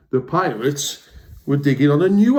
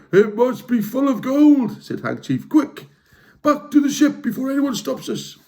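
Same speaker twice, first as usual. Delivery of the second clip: eager pirate; 11 semitones higher than usual. Acted. Hank Chief hurrying the crew, high eager pirate. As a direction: urge the crew off in a rush.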